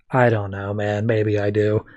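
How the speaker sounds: low, subdued monotone; dejected admission. Retold or imitated. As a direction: act out a quiet, defeated confession. Haunted man admits he feels guilty.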